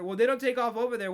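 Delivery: ordinary talk, nothing marked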